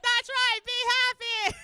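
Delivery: high, loud shout; overexcited cheer. Acted. Mother cheering skipping daughter, overexcited shout. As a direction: shout it with forced joy.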